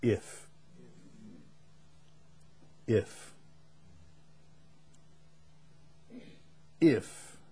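the word if repeated with long pauses; stressed if. Shown as a demonstration. Preacher dwelling on if. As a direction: let if hang, then again. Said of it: "You know what if is?".

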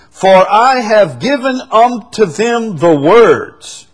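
loud, high proclamation of scripture; forceful preaching. Real, not acted. Preacher proclaims verses on God's word to the congregation, forceful preaching.

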